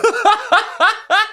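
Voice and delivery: very high, incredulous retold outburst; mock disbelief. Traveler told to rebook his flight, mock disbelief. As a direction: squeal it in hammed-up disbelief.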